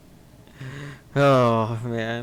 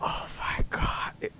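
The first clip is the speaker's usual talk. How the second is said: whispered; 7 dB quieter than usual. Real, not acted. Disgust at the sequel.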